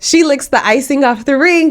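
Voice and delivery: ordinary talk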